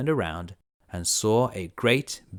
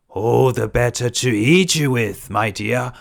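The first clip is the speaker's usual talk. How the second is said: louder, menacing wolf; wolf unmasked; 2 semitones higher, 8 dB louder than usual. Acted. Wolf springing at the girl, loud and menacing. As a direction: drop the disguise and threaten. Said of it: "said the wolf as he sprang".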